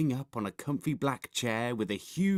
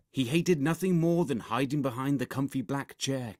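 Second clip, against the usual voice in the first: ordinary delivery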